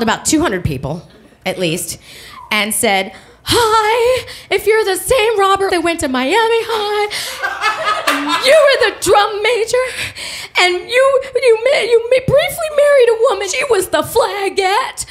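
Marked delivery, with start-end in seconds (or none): 3.4-15.1 high, pleading, laughing-crying message; hammed-up tearful plea on an answering machine; desperate voicemail.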